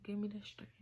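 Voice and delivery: hushed, breathy plea to God; barely voiced, praying under the breath; whispered prayer. Real, not acted. Speaker whispering a prayer for strength, hushed.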